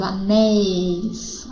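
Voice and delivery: "anéis" drawn out with an open e; "anéis", slow open-vowel demonstration; open diphthong. Shown as a demonstration. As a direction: stretch the "éi" in "anéis".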